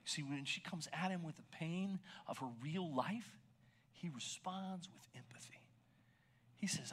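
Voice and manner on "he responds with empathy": a soft whisper of comfort; whispered tenderness. Real, not acted. Preacher to the congregation, in a tender whisper. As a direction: hush it like a secret. Tender point about Jesus.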